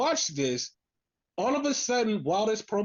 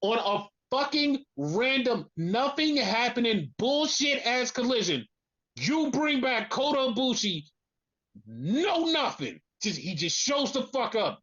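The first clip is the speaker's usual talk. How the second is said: retold phone-throwing exasperation, stretched out; exasperated outburst; 4 semitones higher than usual. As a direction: spit out each phrase in outraged disbelief. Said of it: "threw my fucking phone and went".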